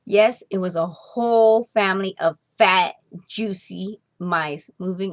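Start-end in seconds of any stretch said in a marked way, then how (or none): none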